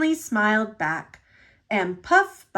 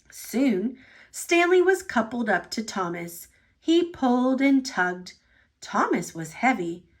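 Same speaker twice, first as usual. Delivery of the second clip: plain reading voice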